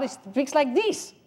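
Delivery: high, put-on squabbling voice; contradicting believer. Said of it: "No, he don't speak like that".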